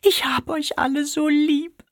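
very high, tender little bird; sentimental bird voice. A narrator's reading. Sentimental sparrow tells the family its love, very high. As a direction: say it tearfully, full of love. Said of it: "besonders rührselig".

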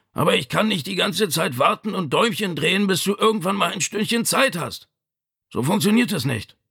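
high-pitched, reproachful ailing father; exasperated old father scolding his son; Vito. A narrator's reading. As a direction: press the complaint in a strained high voice.

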